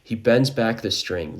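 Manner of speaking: ordinary delivery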